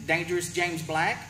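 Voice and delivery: ordinary talk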